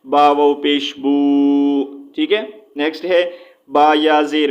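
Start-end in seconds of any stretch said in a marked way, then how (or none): 0.1-1.8 بو stretched very slowly with rounded lips; drawn out boo. Shown as a demonstration. Teacher spells out the madd waw, long بو. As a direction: chant با واو پیش بو, long بو. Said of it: "واو مدہ کی کیا ہے".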